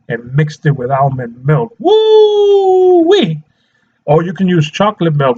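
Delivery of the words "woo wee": long high "woo-wee" of delight; sustained high-pitched cheer; delighted whoop. Real, not acted. Raves about almond milk smoothie.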